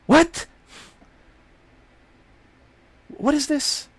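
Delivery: a high, startled yelp of disbelief; shocked what. Pretend. Stunned by a date quizzing him about money, high startled disbelief. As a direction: jump up high in mock shock.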